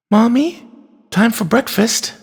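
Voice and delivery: put-on high little-girl voice, coaxing; child waking mother. A narrator's reading. Chloe waking her sick mother for breakfast, high and coaxing. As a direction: call gently upward, high and questioning.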